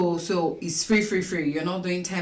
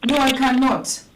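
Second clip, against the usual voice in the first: to someone hard of hearing; 7 semitones higher, 7 dB louder than usual. Real, not acted. Caller on a bad phone line.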